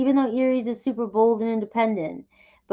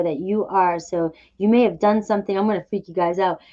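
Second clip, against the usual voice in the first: ordinary talk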